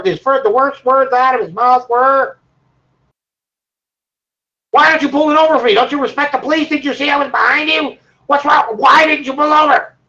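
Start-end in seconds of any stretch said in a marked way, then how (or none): 4.7-9.9 higher, fast, rising to a shout; a furious cop grilling the driver; angry cop. Retold or imitated. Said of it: "he was livid".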